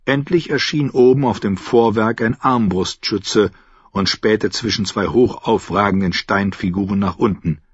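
ordinary delivery

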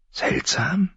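high, hushed rising question; intensely curious. A narrator's reading. Pyp hearing of odd corpses, intensely curious. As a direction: ask it softly, pitch leaping with curiosity. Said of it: "Pip war die Neugier selbst".

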